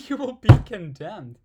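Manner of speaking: can't keep a straight face, loud and laughing. Real, not acted. Laughing at an absurd verse.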